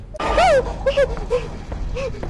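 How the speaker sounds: high panicked laugh; nervous shrieking laugh. Real, not acted. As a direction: laugh in sheer fright. Streamer panics at creature.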